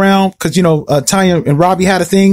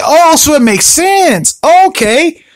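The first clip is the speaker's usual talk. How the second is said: dawning realization; 8 semitones higher, much slower than usual. Retold or imitated. Slow realization.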